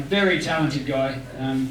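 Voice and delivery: normal talking voice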